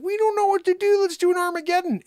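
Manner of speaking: high, loud mock panic; sudden high-pitched alarm; panicked high cry. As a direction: squeal it like a frightened crowd. Acted panic quote.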